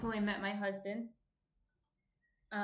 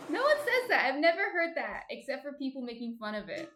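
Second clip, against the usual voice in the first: usual speaking voice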